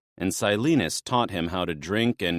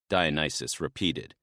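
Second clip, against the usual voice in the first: ordinary delivery, nothing marked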